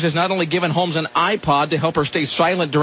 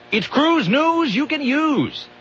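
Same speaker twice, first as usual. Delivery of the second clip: hammed-up slow, higher announcer slogan; 10 semitones higher, much slower than usual. Host announcing his cruise news segment in a hammed-up jingle voice. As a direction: sell the segment like a cheesy jingle.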